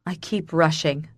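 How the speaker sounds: ordinary delivery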